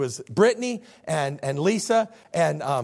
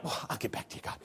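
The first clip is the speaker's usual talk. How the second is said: hushed, sheepish aside, much softer; evasive Balaam; 5 semitones lower, 12 dB quieter, much faster than usual. Acted. Balaam putting off God, hushed evasive mumble. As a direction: drop to a breath and slip away. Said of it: "So Balaam's like".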